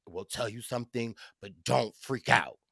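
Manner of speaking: low, hushed retelling of a warning; confidential heads-up. Retold or imitated. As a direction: imitate friends easing into news, softly. Retold friends breaking news in confidence.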